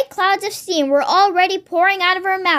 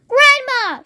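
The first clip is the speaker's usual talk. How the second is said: shriek; 8 semitones higher than usual. Acted. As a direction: scream her name in alarm. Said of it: "shrieked Missus Cranky".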